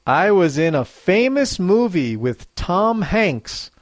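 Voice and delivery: usual speaking voice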